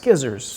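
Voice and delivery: "skizzers" with a hard sk at the start; joke pronunciation. Shown as a demonstration. Co-host jokingly correcting how scissors is pronounced, mock-instructive. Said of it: "It's pronounced skizzers".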